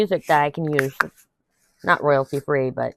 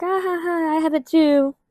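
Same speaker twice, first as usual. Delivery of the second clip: much higher ditzy giggle voice; mock airhead; 12 semitones higher than usual. Mimicking someone claiming ADHD, high silly voice. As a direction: play a silly copycat laughing it off. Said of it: "started being like".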